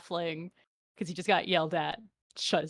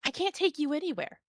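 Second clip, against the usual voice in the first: angry halfling; 9 semitones higher than usual. Acted. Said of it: "the halfling just, like".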